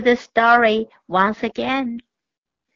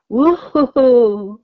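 slow, louder owl hooting; 6 dB louder, slower than usual. A narrator's reading. Owl hooting above the cat and mouse, slow hoot. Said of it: "a strange sound above their heads".

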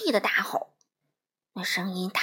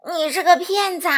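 upset accusation; 7 semitones higher, 9 dB louder than usual. A narrator's reading. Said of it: "稻草人伤心地说".

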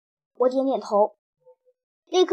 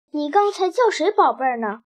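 usual speaking voice

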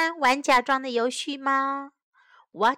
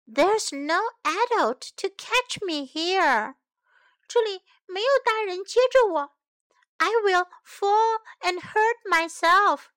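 May's worry; 7 semitones higher than usual. Acted. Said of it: "she says".

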